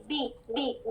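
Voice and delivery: بی with a short clear ee, very soft; kasra sound. Shown as a demonstration. Teacher demonstrating the kasra on ب, soft ee sound. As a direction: give ب a clean ee. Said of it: "ب کی کسرہ sound کیا ہوگی؟".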